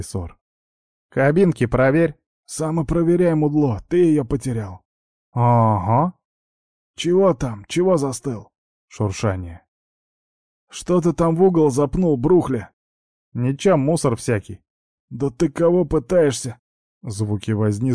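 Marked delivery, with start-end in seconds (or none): 1.1-8.5 loud rough thug voices; from another room. Acted. Thugs in the toilet arguing. 10.7-16.6 higher, louder rough accusing; angry thug. Acted.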